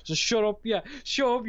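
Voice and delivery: normal talking voice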